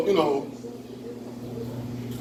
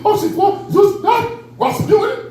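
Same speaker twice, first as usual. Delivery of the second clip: mock German; 12 semitones higher, slower than usual. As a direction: fire off phoney German in short high bursts. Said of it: "Let me apologize in German".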